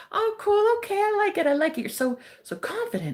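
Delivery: a hint of a smitten orderly; cute guy.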